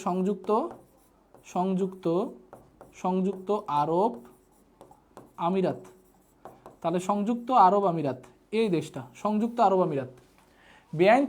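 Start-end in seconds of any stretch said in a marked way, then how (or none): 0.1-5.8 slow dictation. Shown as a demonstration. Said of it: "teacher spells out country name".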